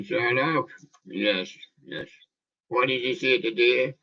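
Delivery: usual speaking voice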